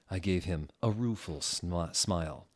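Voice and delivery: ordinary delivery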